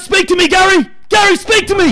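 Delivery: very high, loud overexcited commentator plea; mock ecstatic shout. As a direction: beg your co-host in hammed-up ecstasy. Commentator begs co-host.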